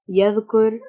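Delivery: ordinary talk, nothing marked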